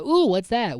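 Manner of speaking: high, loud mock curiosity; Sammy. Podcaster imitating an interrupting lab visitor, loud and curious. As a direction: burst in with a swooping ooh. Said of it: "Sammy's like".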